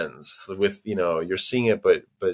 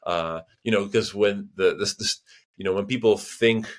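ordinary talk

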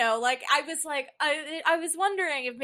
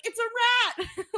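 startled shriek; 12 semitones higher, 4 dB louder, slower than usual. Retold or imitated. Retold panicked shout at rat.

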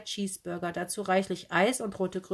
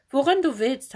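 eager; 6 semitones higher, 8 dB louder than usual. A narrator's reading. Luring aunt back inside.

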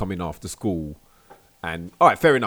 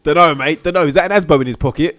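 loud, higher bouncer grilling; 6 semitones higher, 11 dB louder than usual. Pretend. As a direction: interrogate him at the door, loudly. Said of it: "He's like".

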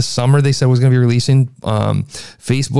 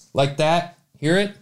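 room echo; 7 semitones higher, 4 dB quieter than usual. Real, not acted. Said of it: "You hear that echo back there?".

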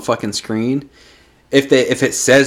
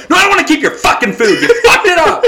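fast, high laughing outburst; a customer telling off the cashier over a botched order; mock angry rant; 3 dB louder, faster than usual. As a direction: rattle off the indignant comeback, laughing. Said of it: "I was so ready to turn and go".

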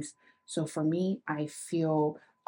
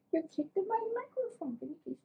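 much higher and softer coo; 13 semitones higher, 8 dB quieter than usual. Real, not acted. Talking to the dog after it kicks mic.

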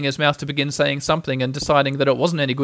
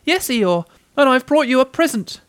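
high, small, eager voice; little Piglet, eager; 10 semitones higher, 5 dB louder than usual. A narrator's reading. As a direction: pipe up high and a bit loud.

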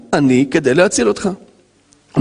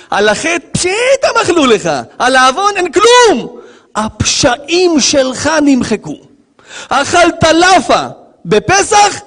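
high, emphatic preaching; triumphant proclamation; 12 semitones higher, slower than usual. Real, not acted. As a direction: proclaim the pardon to the congregation, stretching words. Proclaims forgiveness at full force.

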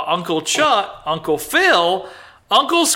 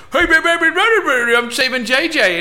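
higher, hammed-up taunting voice; mock movie villain; 6 semitones higher, faster than usual. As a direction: croon it like a cheesy villain. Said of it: "He's like".

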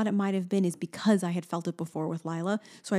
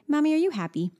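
imitating a small child, higher-pitched; toddler daughter; 8 semitones higher than usual. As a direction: voice the toddler's question up high. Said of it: "And she goes".

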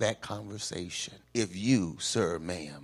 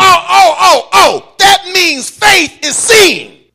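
high, full-force exclamation, slow at the end; preacher's shout; 19 dB louder, slower than usual. Real, not acted. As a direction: cry out the revelation to the congregation. Preacher seizes on the point.

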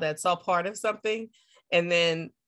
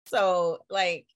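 ordinary talk

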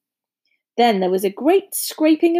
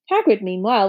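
usual speaking voice

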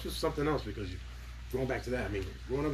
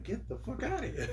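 high pitched incredulous outburst; mock disbelief; disbelieving; 13 semitones higher than usual. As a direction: act stunned by the laughs. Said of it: "and I was like".

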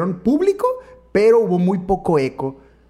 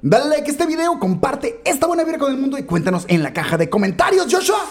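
announcer; 9 semitones higher than usual. Acted. Outro call to action.

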